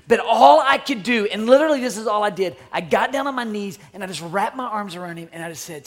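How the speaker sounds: ordinary talk, nothing marked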